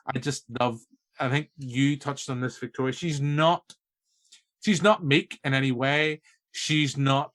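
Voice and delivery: normal talking voice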